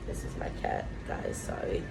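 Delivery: soft unvoiced whisper to the camera; whispered aside. Real, not acted. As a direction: whisper an apology about the cat. Aside whispered about her cat.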